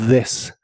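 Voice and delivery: "this" with th buzzing like a vibrating d; "this" con th vibrante; voiced th. Shown as a demonstration. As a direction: buzz the th of "this". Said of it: "suena como una D vibrante".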